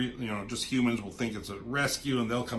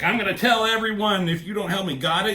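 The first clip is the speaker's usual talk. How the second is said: loud, higher snarled threat; raised menacing voice; threatening shout; 8 semitones higher, 6 dB louder than usual. Retold or imitated. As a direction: threaten her loudly, bullying. Villain threatens her, acted quote.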